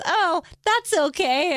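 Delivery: high, slow patronising pity; high slow mock-sympathetic voice; condescending lady. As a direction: talk down like a pitying old lady. Old lady voiced in story.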